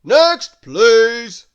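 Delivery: high, very loud shopkeeper's call; big shouted summons; thundering. A narrator's reading. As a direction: thunder for the next customer. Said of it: "thundered the grocer".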